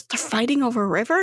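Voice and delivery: "They're fighting over a river?" rising at the end; quick, puzzled question ending up on "river"; questioning repeat. Acted. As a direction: ask it back, lifting "river". Same line repeated as question.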